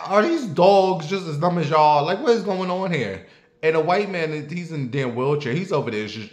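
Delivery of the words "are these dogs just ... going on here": higher, louder exasperated retort. Retold or imitated. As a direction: snap at the lady in disbelief. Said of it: "I'm like".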